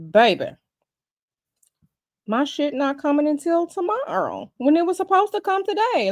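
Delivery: ordinary talk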